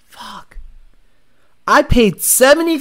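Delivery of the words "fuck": normal talking voice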